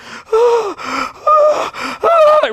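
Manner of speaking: very high, hammed-up weeping gasps; mock sobs; devastated sobbing gasps. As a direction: gasp and wail like a heartbroken movie heroine. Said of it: "she's devastated for, like, several months".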